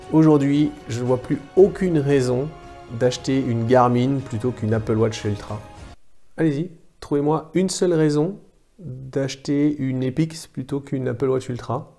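ordinary delivery

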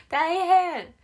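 giggling about a hectic day; laughing. Real, not acted. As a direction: laugh it out, overwhelmed. Laughs about running around.

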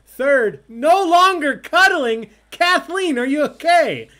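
very loud, high, drawn-out shouting; mock-alarmed yelling. Real, not acted. Host teasing a player in standings, mock-alarmed yell. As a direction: yell it in exaggerated concern. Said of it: "Sorry, I'm yelling".

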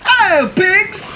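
high, slow and bright hello from Duck; cheerful duck greeting. A narrator's reading. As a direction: sing out a friendly hello as Duck.

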